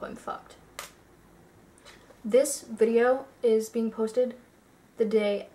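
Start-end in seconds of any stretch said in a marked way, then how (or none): 0.0-0.6 whispered aside. Real, not acted. Aside about copyright worry.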